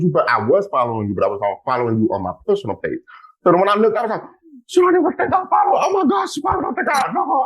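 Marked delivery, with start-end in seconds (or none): 4.7-7.4 excited squeal. Retold or imitated. Fan squealing at her phone in the store, excited shriek. Excited scream on seeing follow.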